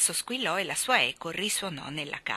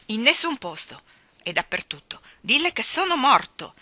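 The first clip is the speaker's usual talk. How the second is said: loud, curt reply; exasperated, hard retort; harsh retort; 6 semitones higher, 7 dB louder than usual. A narrator's reading. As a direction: snap back on the way out. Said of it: "replicò duramente".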